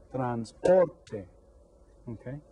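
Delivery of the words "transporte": "transporte" with POR hit harder; stress on second syllable. Shown as a demonstration. Teacher gives the answer, POR stressed in "transporte". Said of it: "The second one".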